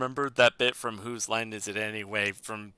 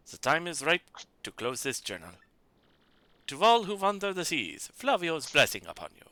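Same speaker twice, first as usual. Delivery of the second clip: put-on mild French accent; 3 semitones higher than usual. Flavio's journal speech.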